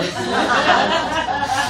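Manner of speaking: a high burst of laughter; real laugh. Real, not acted. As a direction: laugh at your own reading. Laughs after reading a line.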